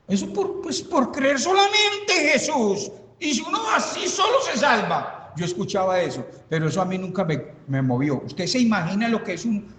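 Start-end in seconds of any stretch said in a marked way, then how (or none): 0.1-5.3 high, scoffing voice mimicking the pastors; mocking imitation. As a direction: make fun of their line with a laugh. (imitando con burla a los pastores mayores)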